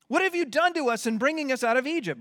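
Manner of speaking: complaining Israelites. Retold or imitated. Israelites accusing Moses in a quick, high whine. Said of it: "they know what's gonna happen".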